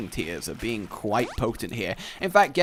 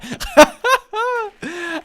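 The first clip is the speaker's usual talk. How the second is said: loud, high cackling laugh; 7 dB louder than usual. Real, not acted. Player amused by his own skit, laughing. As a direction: burst out laughing, high-pitched.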